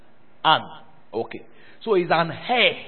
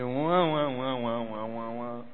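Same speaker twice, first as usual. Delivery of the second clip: sustained wavering "wah" sound, trailing softer; imitated keyboard wail; 2 semitones lower, 4 dB quieter, much slower than usual. Preacher mocking the keyboardist's background playing, imitated wailing. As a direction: mimic the background keyboard with droning wahs. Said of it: "Someone like Rosan must be doing".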